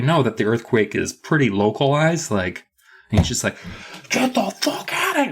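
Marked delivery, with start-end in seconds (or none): 4.1-5.3 very high, strained mock yell; overdramatic panic. Todd fleeing a small earthquake, overdramatic panic. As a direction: imitate someone wildly overreacting. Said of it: "Todd is so dramatic about it".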